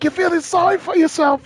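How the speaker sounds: high-pitched English corner coach urging his fighter; a coach in an English accent, pitched well up; high English accent, pushing a downcast fighter. Retold or imitated. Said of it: "they all got those English accents".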